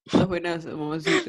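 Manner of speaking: lightly voiced fuckboy catcalling; hint of a fuckboy, breaking into a laugh. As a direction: toss it out like a fuckboy. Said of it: "decirlo como de fuckboy".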